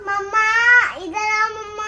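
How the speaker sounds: loud, high sing-song call for mom. Acted. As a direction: call mom from another room, drawn out. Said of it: "क्यों चिल्ला रही है?". (ऊँची आवाज़ में मम्मा को पुकारना)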